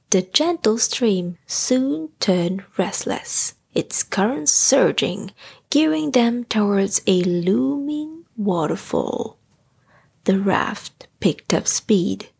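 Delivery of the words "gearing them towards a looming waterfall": plain reading voice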